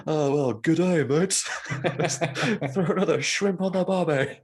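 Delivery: laughing mock Australian; film Aussie accent. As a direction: quote the Dumb and Dumber Aussie line in a put-on Australian. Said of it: "and he goes".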